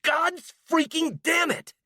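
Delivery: high, slow, raging curse; furious curse. Acted. A young woman finding her things stolen, cursing in rage. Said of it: "she cursed, her face red with rage".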